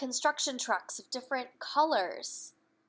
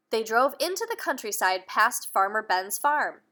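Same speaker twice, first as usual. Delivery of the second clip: put-on reading aloud to children; lively bedtime-story narration; storybook reading; 7 dB louder than usual. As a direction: tell the story to little ones. Reading a picture book aloud.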